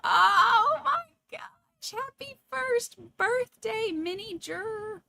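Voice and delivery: high, slow, tearful birthday wish; choked-up crying. Real, not acted. Mother wishing her baby happy birthday, crying. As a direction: let the voice break with emotion. Baby's first birthday, emotional.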